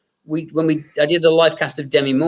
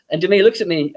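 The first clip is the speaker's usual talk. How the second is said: ordinary talk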